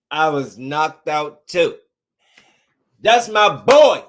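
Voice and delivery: slow, loud and proud hype for his fighter; excited cheer. Real, not acted. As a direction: drag it out, hyped and proud.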